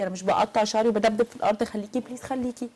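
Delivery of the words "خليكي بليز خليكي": much softer, coaxing; soft pleading. Real, not acted. Host softly begging the guest to stay. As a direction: gently beg her to stay.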